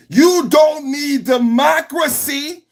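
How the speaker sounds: high, slow sneering mock; mocking high drawn-out taunt. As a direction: stretch it out like a mocking official.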